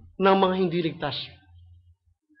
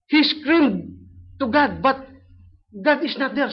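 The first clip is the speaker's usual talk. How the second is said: raised preaching voice; 8 semitones higher, 4 dB louder, slower than usual. Retold or imitated. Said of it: "sabi niya".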